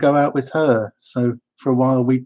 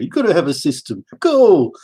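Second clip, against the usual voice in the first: Harry Hill catchphrase; 5 semitones higher, 4 dB louder than usual. Retold or imitated. Quoting Harry Hill radio catchphrase.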